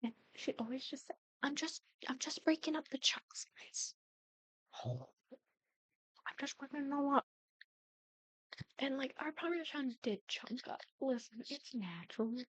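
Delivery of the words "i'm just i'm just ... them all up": lightly imitated kid, pitched higher; higher-voiced mock-innocent explanation; little sister's excuse.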